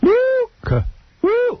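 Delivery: very high falsetto hoot; imitated woo calls. Host copying a fan's chant, falsetto woos. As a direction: whoop way up high like the fan. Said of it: "he does this weird woo-woo thing".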